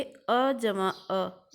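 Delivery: each sound stretched out slowly: "अ जमा अ"; very slow demo. Shown as a demonstration. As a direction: spell out "अ जमा अ" very slowly for the students. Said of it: "उदाहरण से समझेंगे". (बहुत धीरे, खींचकर "अ जमा अ")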